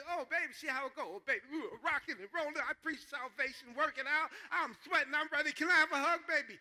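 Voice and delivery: high, loud, hammed-up revival preacher; excited mock preacher working the crowd; storm-preaching act. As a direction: preach it up wildly, building to a shout. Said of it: "Now, I can preach up a storm".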